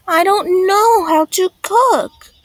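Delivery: high, loud moan of complaint. A narrator's reading. Sophie to teacher about the cooking task, moaning. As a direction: moan it as a protest, pitched well up. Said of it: "moaned Sophie".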